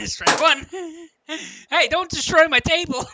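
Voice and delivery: high, loud alarmed outburst; loud protest. Real, not acted. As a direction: yell at him for the damage. Something hit the table.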